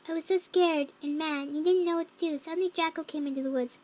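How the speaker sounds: ordinary delivery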